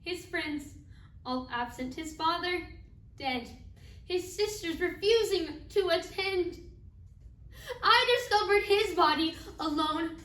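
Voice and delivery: a bereaved mother forcing out each hard fact; anguished grief. Acted. Acted mother recounts burying son alone.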